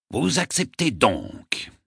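slow, high, ceremonial intoning; solemn. A narrator's reading. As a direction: stretch it out like an oath being administered. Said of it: "d'une voix solennelle".

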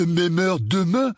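high, slow loutish voice; Dudley, gang leader. Acted. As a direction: call it out to the gang, drawn out. Gang leader arranging next meeting. (voix aiguë et traînante de petite brute)

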